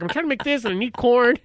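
high, mildly exasperated mimicry; mad wife complaint. Wife fed up while trying to cook, mock-mad complaint. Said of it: "She was really mad".

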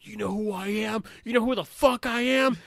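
loud, high mock outrage; irate sergeant. Furious sergeant acted out.

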